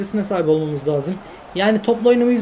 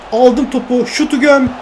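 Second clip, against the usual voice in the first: shouted loud and high; excited shout; 4 semitones higher, 5 dB louder, slower than usual. Real, not acted. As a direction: yell for the shot to go in. Cheering own shot in game.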